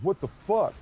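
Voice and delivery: high, loud confused outburst; jolted awake and baffled; startled. Retold or imitated. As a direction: drunk man waking up startled. Drunk driver wakes, confused.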